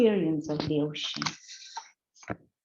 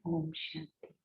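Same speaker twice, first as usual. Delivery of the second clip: meditation voice. Acted. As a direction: speak gently, like ending a guided sitting. Closing words of a meditation.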